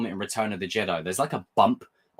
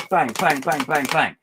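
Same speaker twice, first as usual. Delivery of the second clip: punchy, rapid-fire bangs; imitated blows; 4 semitones higher, 4 dB louder than usual. Fan acting out Obi-Wan smacking Vader's chest plate, punchy banging. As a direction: hammer out each bang a little louder.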